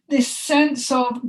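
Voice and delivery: normal talking voice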